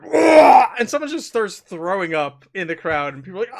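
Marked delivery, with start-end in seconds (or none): 0.0-0.7 retching yell. Acted. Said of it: "all of a sudden you hear".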